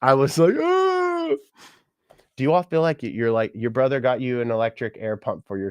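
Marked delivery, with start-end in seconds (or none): 0.6-1.3 a sudden very high mock sob-wail; imitated ugly-cry wail. Retelling opening grandfather's gift, a mock high crying wail. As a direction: squeal out a hammed-up crying wail. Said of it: "but I ugly cried".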